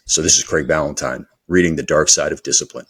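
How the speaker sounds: low, smooth audiobook radio voice; deep, measured radio narrator. Shown as a demonstration. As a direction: drop down into a polished announcer read. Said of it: "I have my radio voice".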